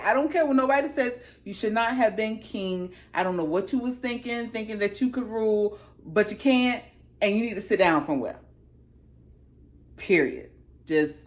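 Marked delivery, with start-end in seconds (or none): none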